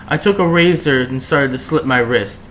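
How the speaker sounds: ordinary delivery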